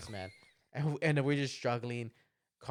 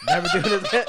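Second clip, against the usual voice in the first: laughing mock vow; 12 dB louder than usual. Sister's vow, retold.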